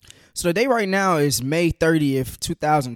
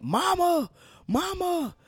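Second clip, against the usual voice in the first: high, pleading cry of Mama, softer each time; imitating a dying man calling for his mother; imitated yell; 4 dB quieter, much slower than usual. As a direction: call out for a mother in anguish, fading. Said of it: "he's yelling".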